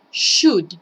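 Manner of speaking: should said loud and clear with falling tune; model pronunciation. Shown as a demonstration. Teacher giving should for the class to repeat, loud and clear. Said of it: "Listen and repeat".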